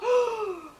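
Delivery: sharp high gasp of fright; scared child's gasp; frightened gasp. Acted. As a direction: gasp in shock. Child sees carrots on wall.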